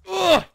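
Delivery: high, loud "ugh"; exasperated grunt. Real, not acted. Player mocked by the game, exasperated groan. Frustrated at the jump.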